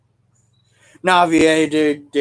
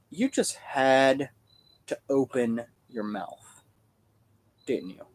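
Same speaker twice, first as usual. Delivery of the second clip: slow, softer, resigned grumble; exasperated aside; 3 semitones lower, 7 dB quieter, much slower than usual. Retold or imitated. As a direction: drag it out like you saw it coming. Resigned retort to the friend.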